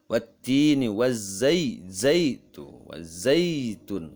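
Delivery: "wazzaitun" with zai held long; lengthened glide. Shown as a demonstration. Teacher points out mad layin, lengthened "wazzaitun". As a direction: stretch the ai in "wazzaitun". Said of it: "Nah, itu mad".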